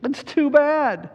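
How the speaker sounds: put-on high, slow wail of a girl; mock sob, drawn out and high; sobbing Lucy. A narrator's reading. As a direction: voice a child character's grief. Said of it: "sobbed Lucy".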